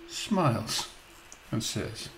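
ordinary talk, nothing marked

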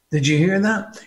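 normal talking voice

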